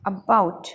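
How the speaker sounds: About with a forceful -BOUT; stress on second syllable. Shown as a demonstration. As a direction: press on the b in About. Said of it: "जोड़ दे के पढ़ोगे".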